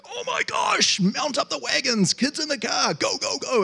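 high-pitched eager cry to pile into the car; excited rush. Retold or imitated. Parent rushing the kids for free hamburgers, high excited cry. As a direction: call the family out the door in a hurry. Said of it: "It's that kind of excitement".

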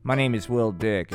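ordinary talk, nothing marked

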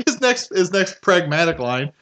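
normal talking voice

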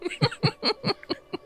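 high mock vampire cackle; a hammy villain laugh, way up high; evil cackle. Host's spooky laugh.

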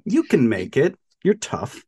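high, teasing pep talk; mock encouragement. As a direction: cheer them on insincerely.

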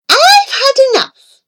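high, loud, fed-up snap; she's lost patience, let it burst out; exasperated mother. A narrator's reading. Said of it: "my mother said".

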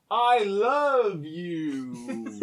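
very slow, stilted line reading; mock clumsy love line; bad wooden acting. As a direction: deliver it like a terrible soap actor. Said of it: "really bad at acting".

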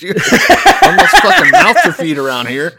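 loud, high, laughing through the words. Acted. Husband happily giving his son away, loud and laughing. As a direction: crack up while playing the husband.

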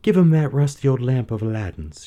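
lightly voiced eager princess. A narrator's reading. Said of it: "she said".

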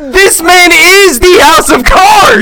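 slow, high, drawn-out proclamation; mock outrage. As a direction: declare it theatrically.

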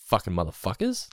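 retold outrage. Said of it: "I was like".

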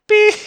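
loud high beep made by voice; imitated censor beep. Host hiding where he works, loud imitated censor beep. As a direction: bleep out the word with a long high tone. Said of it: "We'll just beep that out".

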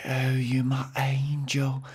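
slow, hushed put-on accent; Drusilla's accent. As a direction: murmur it close, in an odd mock accent. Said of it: "like, very closely, and she's like".

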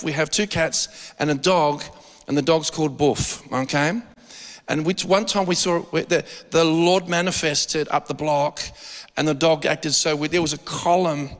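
ordinary delivery

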